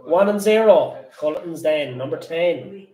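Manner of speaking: loud sing-song bingo calls; bingo caller. Acted. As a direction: call the numbers like a bingo hall. Bingo number calling.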